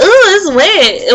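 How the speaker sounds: high, drawn-out mock reaction; mimicked surprise. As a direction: imitate someone startled by what they touched. Mimicking people who touched wet hair.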